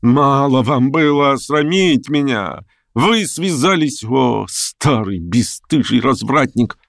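высокий, медленный возмущённый упрёк; обличай старика высоким голосом, растягивая слова; гневное обличение. A narrator's reading. Разгневанная женщина обличает старика. (возмущённо, с растяжкой)

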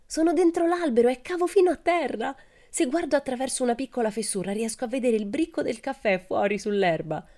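high, excited call from below. A narrator's reading. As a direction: call up from inside a hollow tree. Calling from inside hollow tree.

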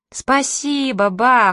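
high, loud sing-out of thanks; shouted in chorus. A narrator's reading. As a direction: call it out like obliging kids. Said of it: "хором закричали мы".

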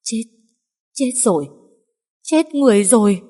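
put-on rising panic over a dead girl; panicked. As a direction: gasp it out in growing horror. Panicked discovery of a death.

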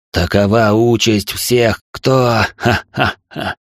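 slow, loud, high gurgling cackle; gurgling laugh. Acted. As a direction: let the darkness burst into gurgling laughter. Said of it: "Тьма засмеялась клокочущим смехом".

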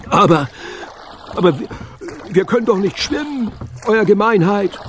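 high, stammering desperate protest; Messerfisch screeching desperately. A narrator's reading. Steersman objects he cannot swim, desperate screech. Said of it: "kreischte Messerfisch verzweifelt".

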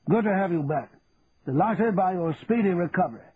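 lightly voiced genial colonel; a hint of an officer's polish; military officer. A narrator's reading.

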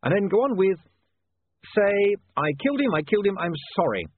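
high, loud, pressing dictation; insistent, pitched well up; urgent dictation. A narrator's reading. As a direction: urge the typesetter on. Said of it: "said William urgently".

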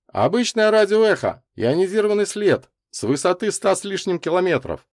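high, emphatic technical answer; reporting professor. A narrator's reading. Лазарев answers Микульский on radar data, reporting.

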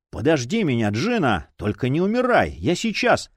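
ordinary delivery, nothing marked

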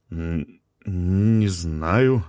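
unsure. A narrator's reading. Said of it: "сказал Полисов неуверенно".

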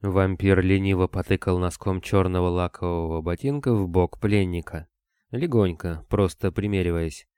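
ordinary delivery

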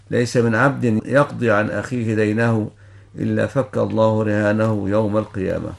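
ordinary talk

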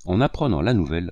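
ordinary delivery, nothing marked

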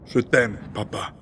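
hushed, intimate and trailing away; tender confession. Acted. Private words to father after oration.

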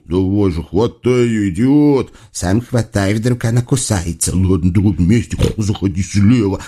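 two devils hissing at each other; conspiratorial squabble; hissed bickering. A narrator's reading. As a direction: hiss urgent orders to your accomplice. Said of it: "шипят друг на дружку".

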